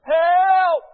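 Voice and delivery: full-throated high shout; drawn-out, high-pitched holler; yelled cry for help. Retold or imitated. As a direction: yell it out for a nurse, urgent and very loud. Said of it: "I yelled it again".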